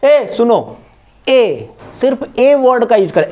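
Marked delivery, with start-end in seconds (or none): 0.1-1.7 mild call to get attention. Retold or imitated. Teacher imitating how people call someone. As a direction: call someone over casually. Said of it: "आप कभी-कभी बोलते होगे".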